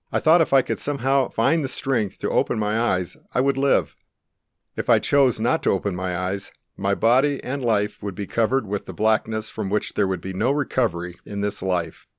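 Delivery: usual speaking voice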